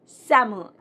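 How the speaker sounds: "salmon" as SAM-un; correct salmon. Shown as a demonstration. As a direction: silent L in "salmon". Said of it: "The correct pronunciation".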